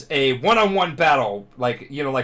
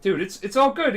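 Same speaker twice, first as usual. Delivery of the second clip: higher, hammed-up crying reassurance; mock tearful; 9 semitones higher than usual. One crying friend telling another it's all good, mock tearful. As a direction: reassure a friend through pretend tears. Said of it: "and they're crying".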